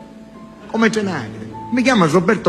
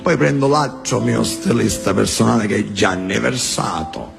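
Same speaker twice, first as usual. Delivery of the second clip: low, menacing Sicilian boss; deep Sicilian mafia voice; Sicilian mafioso; 6 semitones lower than usual. Retold or imitated. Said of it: "Sentite l'originale".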